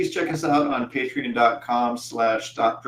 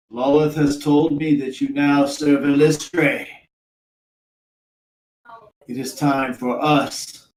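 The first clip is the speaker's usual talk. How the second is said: raised, declaiming matron's voice; yelling out; 4 semitones higher, 4 dB louder than usual. Acted. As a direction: proclaim it across the battlefield. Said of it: "and yells out".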